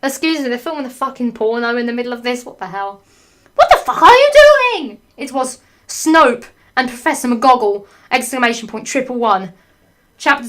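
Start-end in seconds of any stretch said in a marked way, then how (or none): 3.6-5.0 high, very loud hammed-up shriek; outraged shout. Teacher catching the couple in the act, outraged screech. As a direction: yell it in scandalised outrage.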